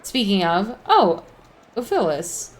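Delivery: ordinary talk, nothing marked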